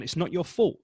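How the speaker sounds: normal talking voice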